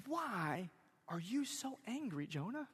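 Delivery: soft, probing question with pauses; hushed question. Acted. Preacher questioning angry Jonah, hushed and searching. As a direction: ask it gently and quietly, a beat after why.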